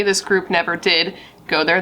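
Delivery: ordinary delivery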